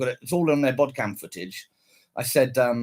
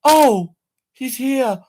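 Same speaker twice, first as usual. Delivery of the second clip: high, loud mocking woman's voice; hammed-up surprised policewoman; mock officer; 9 semitones higher, 8 dB louder, much slower than usual. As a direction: squeal it like the startled officer. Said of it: "she goes".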